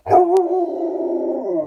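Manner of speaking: sudden loud high exclamation; surprised oh. Real, not acted. Teacher startled by her dog's bark, loud high oh. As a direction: burst out in surprise.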